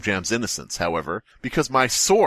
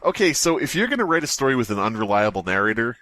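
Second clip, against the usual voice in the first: ordinary delivery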